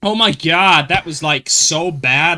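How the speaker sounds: camp American complaining; hammed-up North American accent; put-on American accent. Shown as a demonstration. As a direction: whine about it in an American accent. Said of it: "when I'm doing American".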